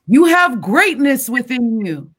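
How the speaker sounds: Les Brown voice. Retold or imitated. Voice from the tunnel, slow and uplifting motivational speaker voice. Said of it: "Mister Les Brown".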